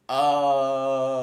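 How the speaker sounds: loud, high oh of realisation; sudden realisation. Real, not acted. Streamer suddenly getting chat's point, loud surprised oh. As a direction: land it like the penny just dropped. Realising what chat meant.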